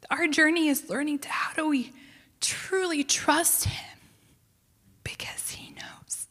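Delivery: real hushed whisper, slowing as it goes. Real, not acted. Preacher urges listeners to trust him, in a fading whisper.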